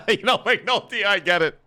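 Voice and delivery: laughing. Real, not acted. Laughs while addressing McNulty.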